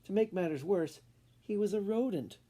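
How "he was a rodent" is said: usual speaking voice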